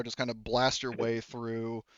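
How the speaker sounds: ordinary talk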